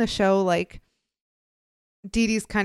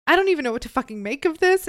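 exasperated outburst; 7 semitones higher, 5 dB louder, faster than usual. Real, not acted. Outraged at the story.

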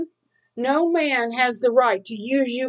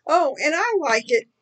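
normal talking voice

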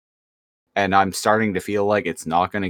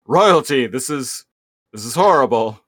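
higher, louder fanatical ant soldier; Inferno; 4 semitones higher, 5 dB louder than usual. Retold or imitated. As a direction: gasp it like a zealous bug servant. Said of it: "Inferno ... is all like".

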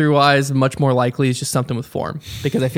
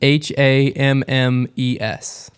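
slow letter by letter spelling; each letter spaced and deliberate; much slower than usual. Acted.

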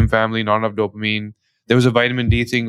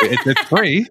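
higher, laughing through it; 5 semitones higher than usual. Real, not acted. Joking with her guest about free healthcare, laughing. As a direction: laugh at the irony.

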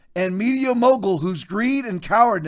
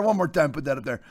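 ordinary delivery

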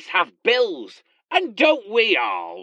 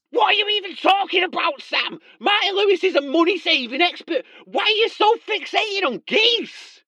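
mock outrage; 13 semitones higher than usual.

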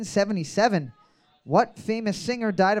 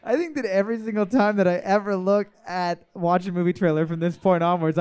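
usual speaking voice

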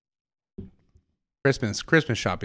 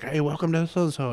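imitating a football announcer, pitched up; raised, projected announcer greeting; 6 semitones higher than usual. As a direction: welcome them like a stadium announcer. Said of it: "This dude had an announcer voice".

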